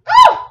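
loud high cheer; cheering woo. Real, not acted. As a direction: whoop to pump everyone up. Cheering during set.

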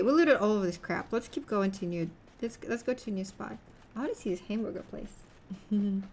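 usual speaking voice

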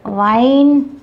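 wine with rounded lips, held and slow; careful demo wine. Shown as a demonstration. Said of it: "See, this is wine".

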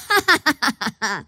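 short gloating laugh; villain laugh. Acted. Kana savoring a dying enemy, gloating laugh. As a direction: laugh as the foe dies. Laugh after death threat.

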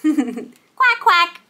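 two short playful quacks; duck quacking. Acted. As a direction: quack lightly like a toy duck. Imitates the toy duck.

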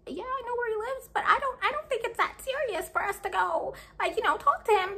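high, soft and meek; a timid younger self backing down; timid weenie voice. Retold or imitated. Timid little sister declining her big sister's offer, meek and soft. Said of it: "me being a little weenie".

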